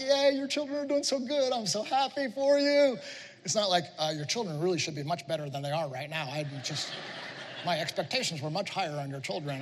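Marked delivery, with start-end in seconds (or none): 0.0-3.0 delighted father voice. Retold or imitated. 4.0-9.6 lightly voiced disappointed nerd, a bit lower; a hint of a fussy, let-down nerd. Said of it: "I'm not sure why I'm talking like a nerd".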